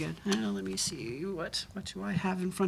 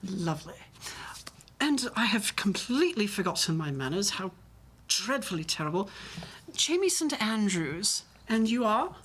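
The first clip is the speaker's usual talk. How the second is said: posh West End English; Jamie Saint Andrews. Acted. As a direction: chat politely in a posh West End accent. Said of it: "my posh West End accent".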